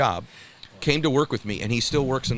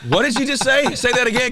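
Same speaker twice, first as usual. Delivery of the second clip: high, loud outraged disbelief; incredulous; 13 semitones higher, 6 dB louder than usual. Retold or imitated. Buyer confronting the kit car seller, incredulous. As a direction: demand a repeat, pitched way up. Retelling his incredulous reaction.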